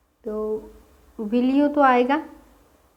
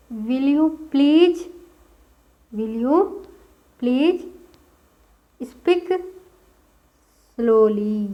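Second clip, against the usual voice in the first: broken up word by word, rising on each; teacher assembling the sentence word by word; building the sentence; 3 dB louder, much slower than usual. Shown as a demonstration. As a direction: piece it together word by word. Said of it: "English में क्या बोला जाता है?".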